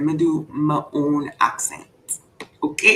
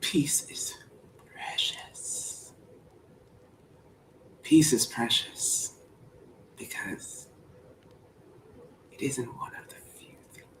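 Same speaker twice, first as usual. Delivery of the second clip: soft whispered reading of the speech; 3 semitones lower, 13 dB quieter, slower than usual. Acted. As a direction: whisper it like a secret. Whispered second try of speech.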